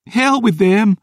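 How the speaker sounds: grieving, furious maid; tearful rage. Acted. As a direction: break down while shouting. Isla glares, tearful.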